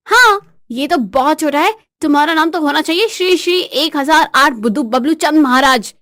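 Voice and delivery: high, emphatic mock honorific; scornful mock ceremony; mocking wife. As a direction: proclaim the insult like a grand title. Wife mocks with grand title.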